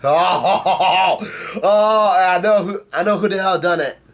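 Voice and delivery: high laughing "oh"s into eager realization; giddy, high-pitched reaction; real excitement. Real, not acted. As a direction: burst out laughing, pitched well up.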